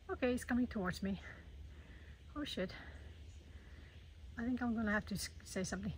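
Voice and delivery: soft, tense, scared whisper; frightened whisper. Real, not acted. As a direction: hold your breath, scared whisper. Bear approaching, frightened hush.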